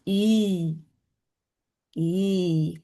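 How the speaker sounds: "E" held long, as in machine; strong I vowel. Shown as a demonstration. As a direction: draw out "E" like "ee", low. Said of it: "we make the strong E sound".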